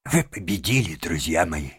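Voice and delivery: ordinary delivery, nothing marked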